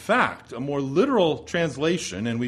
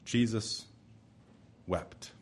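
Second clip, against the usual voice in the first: much softer and lower, almost breathed; a reverent near-hush on "Jesus" then "wept"; hushed reading; 5 semitones lower, 9 dB quieter, slower than usual. Acted. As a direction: let the verse fall into silence.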